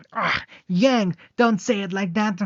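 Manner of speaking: high, loud whiny complaint in another voice; girl embarrassed at Yang's remark, squealing; flustered protest. Acted. Girl protesting to Yang, high flustered whine.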